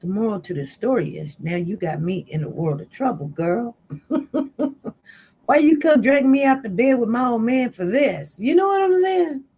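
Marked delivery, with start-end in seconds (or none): 3.8-4.9 short, high burst of laughter; rueful laugh. Real, not acted. Laughing at her own predicament. 5.4-9.4 higher and louder, building indignation; exasperated complaint. Retold or imitated. Blaming friend for dragging her out.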